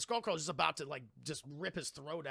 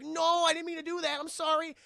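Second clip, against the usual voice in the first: high, loud panicked sorry; frantic apology; 11 semitones higher, 7 dB louder than usual. Retold or imitated. Khan apologizes to the falling woman, high and frantic. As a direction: blurt the apology in alarm. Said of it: "He's like".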